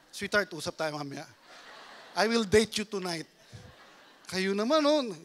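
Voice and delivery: usual speaking voice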